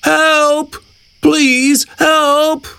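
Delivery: high-pitched cry for help; storybook shout. A narrator's reading. Stuck hippo calls the animals for help, high storybook shout. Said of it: "He shouts".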